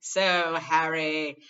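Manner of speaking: loud hearty call; Lockhart hailing Harry. Acted. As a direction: grab his attention. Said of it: "said Lockhart".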